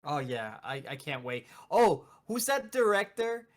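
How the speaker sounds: ordinary talk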